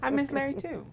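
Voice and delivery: high little-boy voice, lightly imitated; voicing a caring young child; five-year-old son. Host quoting guest's son comforting his mother, high child voice. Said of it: "to look at you and say".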